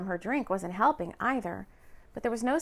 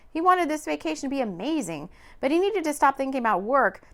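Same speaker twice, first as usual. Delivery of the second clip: ordinary delivery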